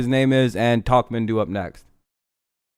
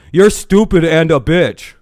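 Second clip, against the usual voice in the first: mocking troll quote; 4 semitones higher, 5 dB louder, slower than usual. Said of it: "He's gonna say some shit like".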